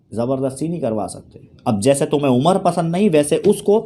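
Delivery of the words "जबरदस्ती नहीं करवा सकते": lower, softer restatement; soft, low afterthought; quiet repeat. Real, not acted. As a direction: drop the voice to restate the point. Low repeat after emphatic statement.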